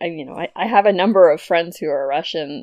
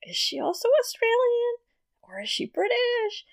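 mock baffled question in a much higher voice; puzzled inner voice; 7 dB quieter than usual. Wondering about the CNN anchor's accent, high mock-baffled voice. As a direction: play your own confusion, pitched way up. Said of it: "and I was like".

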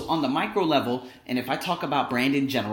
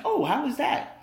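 very high, bright curious question; 13 semitones higher, 3 dB louder than usual. Retold or imitated. Asking the student about the hospital, very high and curious. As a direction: leap way up in curiosity.

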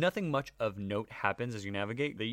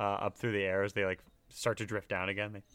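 normal talking voice

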